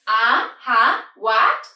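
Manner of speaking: UH, HUH, WHAT each rising sharply, loud; second tone. Shown as a demonstration. Teacher shows question tune, loud rising UH, HUH, WHAT. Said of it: "ask a question".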